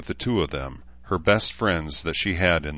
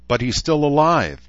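high, loud worried unicorn; high-pitched fretful protest; anxious squeak; 8 semitones higher, 6 dB louder than usual. A narrator's reading. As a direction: squeak it out in alarm. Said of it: "Barb squeaked".

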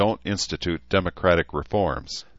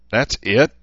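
higher, louder disbelief; can't believe that's all; incredulous; 6 semitones higher, 7 dB louder than usual. A narrator's reading. Daughter incredulous at father.

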